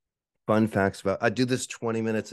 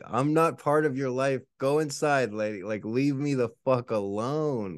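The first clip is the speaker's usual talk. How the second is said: mock telling off; 7 semitones higher than usual. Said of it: "It's like".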